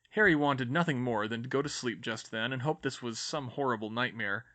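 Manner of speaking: plain reading voice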